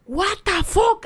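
high, loud retold "what the fuck"; loud disbelief. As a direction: burst out stunned. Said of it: "Así me quedé". (incredulidad a gritos, aguda)

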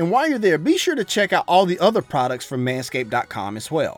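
smooth sponsor read over music. Acted. Host reading a Manscaped sponsor spot, upbeat ad voice. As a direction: sell the razor like a podcast host ad spot.

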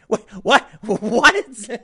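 incredulous laughing what; laughing disbelief. Real, not acted.

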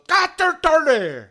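high, loud druid war cry; incantation yell. Acted. Druid casting on the travellers, yelled incantation. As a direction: yell out a strange spell. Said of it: "he yells some kind of thing".

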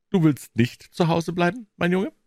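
high, knowing disbelief; mock questioning. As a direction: catch him out with a pointed question. Aunt sees through boy's excuse.

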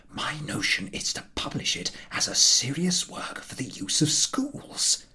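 hushed secret whisper, slowing at the end. Acted. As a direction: whisper it so no one else hears. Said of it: "sunk his voice to a whisper".

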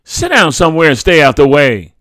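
loud, high snap falling in pitch; yelling. A narrator's reading. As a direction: yell at the child to get out from underfoot. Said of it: "she yelled".

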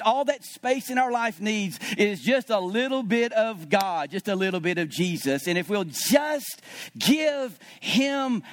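fervent sermon voice; preaching. Acted. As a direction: press the point home to the congregation. Preacher driving home the point.

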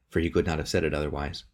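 ordinary delivery

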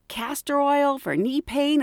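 ordinary delivery

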